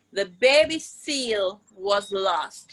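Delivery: normal talking voice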